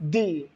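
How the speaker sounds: दी with a long ee, low and soft; low, quiet long-ee दी; THE said "dee". Shown as a demonstration. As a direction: say दी as "dee", dropping in pitch and volume. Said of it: "pronunciation क्या होता है?".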